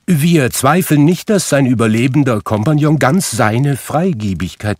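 high, polite collector's voice; a courteous visitor checking his list; polite gentleman. Acted. Gentleman with a list politely addresses Scrooge, high voice.

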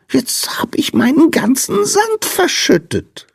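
very high squeaky moan; whining lament. A narrator's reading. Sandman confessing spilt sand to Lina, squeaky whine. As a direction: lament it in a tiny creature voice. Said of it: "jammerte der Sandmann".

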